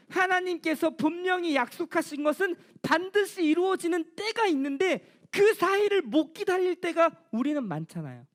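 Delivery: ordinary talk, nothing marked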